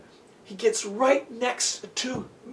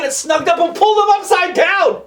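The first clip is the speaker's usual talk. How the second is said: ordinary delivery, nothing marked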